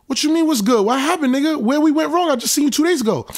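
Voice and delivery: retelling how he pushed back on his friend; retold heated disbelief. On the phone with his friend, high incredulous mock outrage.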